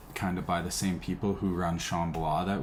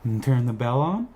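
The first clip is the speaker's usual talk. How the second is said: dog's voice; 5 semitones higher, 5 dB louder than usual. Pretend. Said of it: "He says".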